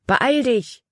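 high, urgent call. A narrator's reading. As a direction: hurry her along across the log. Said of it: "rief Caleb".

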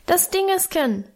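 put-on high little-boy squeak; high-pitched childish peep; piping. A narrator's reading. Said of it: "piepste Hermann".

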